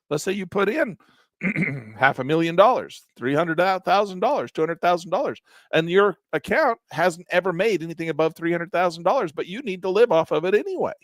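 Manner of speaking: usual speaking voice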